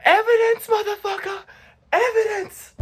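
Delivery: high-pitched mock shout of victory; over-the-top falsetto gloat; triumphant retort. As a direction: squeal it out like damning proof in court. Triumphant retort acted out in story.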